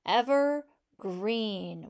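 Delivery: "Evergreen" very slow, split into "ever" and a long "green"; sounded out slowly. Shown as a demonstration. Teacher helping pupils hear the next sound, sounded out slowly. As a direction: stretch "Evergreen" out, pausing between its parts. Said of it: "practice sounding out".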